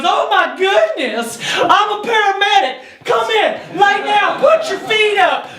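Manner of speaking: put-on high, excited little old lady voice. Old lady paramedic to injured driver at her door, high-pitched fussing. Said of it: "this little old lady opens the door".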